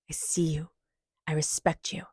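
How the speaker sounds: tender whispered affirmation; hushed, loving whisper. Acted. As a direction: breathe the words softly like a secret to a child. Tender affirmations to inner child.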